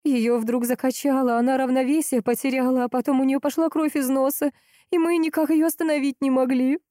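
high, tearful distress; breaking into tears. A narrator's reading. Frightened mother reports daughter's nosebleed, tearful. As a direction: tell it on the verge of crying. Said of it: "расплакалась Ольга".

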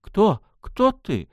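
high, frightened cry; trembling voice. Acted. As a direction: ask with a quivering voice. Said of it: "дрожащим голосом спросила Мила".

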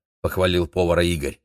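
ordinary delivery, nothing marked

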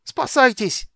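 high, loud cry of alarm; shouting. A narrator's reading. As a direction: shout for everyone to save themselves. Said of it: "крикнул Хедерик". (громкий крик тревоги)

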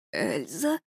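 soft, low, dazed mumble; mumbled. A narrator's reading. Boy waking up mumbles her name, soft and dazed. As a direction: mumble it half awake. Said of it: "он бормочет".

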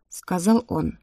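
ordinary delivery, nothing marked

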